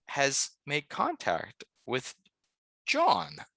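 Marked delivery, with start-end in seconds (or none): none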